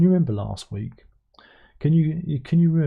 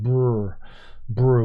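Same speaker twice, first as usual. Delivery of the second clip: shivering brr. Pretend. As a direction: buzz the lips like freezing. Shivering at snow warning.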